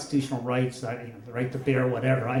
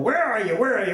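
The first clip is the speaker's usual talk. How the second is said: high, hammed-up booming call; booming father; 7 semitones higher, 4 dB louder than usual. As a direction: holler around the room, imitating dad. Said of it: "he had this booming voice".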